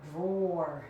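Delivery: "drawer" with a heavy final R; standard pronunciation. Shown as a demonstration. Showing how others say it, exaggerated R in "drawer". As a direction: lean on the R at the end of "drawer". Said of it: "They say drawer".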